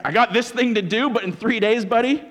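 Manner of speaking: playfully menacing; mock threat. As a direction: warn him payback is coming.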